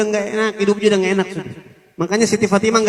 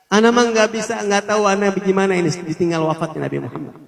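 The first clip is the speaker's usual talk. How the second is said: usual speaking voice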